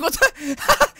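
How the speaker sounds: high, loud imitated disbelief; mock shocked victim. Gamer voices the wronged character, mock shock. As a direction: imitate her outrage through laughter. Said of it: "she's like".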